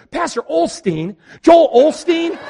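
light up thinking he's the famous pastor; starstruck. Retold or imitated. Stewardess asks the pastor if he is the famous preacher, thrilled. Lady mishears his name excitedly.

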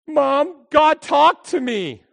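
high, loud, slow confession; shaken daughter. Retold or imitated.

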